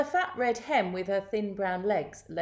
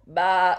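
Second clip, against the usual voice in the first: loud bleat at an ordinary pitch; sheep baa; 8 dB louder than usual. A narrator's reading. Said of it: "said the sheep".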